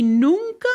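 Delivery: nunca shouted high and loud; emphatic nunca. Real, not acted. Insisting she never faced racism, emphatic nunca. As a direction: punch nunca with a high rise.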